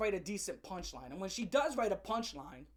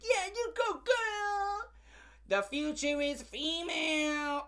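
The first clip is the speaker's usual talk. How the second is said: squealing high fake-weepy cheer; mock cheering fan; 6 semitones higher, much slower than usual. Mocking fans cheering a comedian on, in a squealing falsetto. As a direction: gush like a teary superfan, pitched way up.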